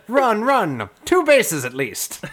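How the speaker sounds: high, loud, laughing cheer; excited shout. Acted. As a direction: urge the runner on, thrilled. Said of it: "and Ernest cries".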